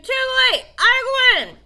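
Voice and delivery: very high, loud triumph; Billy shouting down his victory; gleeful yell. Acted. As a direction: crow it out, mouth full. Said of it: "Billy yelled".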